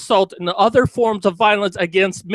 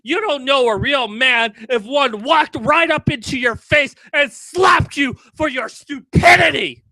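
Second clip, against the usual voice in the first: furious shouting; 5 semitones higher than usual. Real, not acted. Ranting at the author of the piece, furious shouting. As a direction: scream it out, full of rage, hitting every curse.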